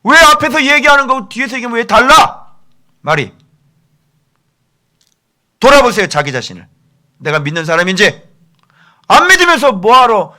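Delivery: loud, high-pitched accusing demand; stern rebuke. Shown as a demonstration. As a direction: confront the hypocrite at full volume. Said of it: "어미 꾸짖으라". (큰 소리로 엄하게 질책하듯)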